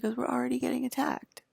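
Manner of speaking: high-pitched, imitated tearful protest; retold crying. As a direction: mildly mimic someone upset and crying. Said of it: "he started crying too".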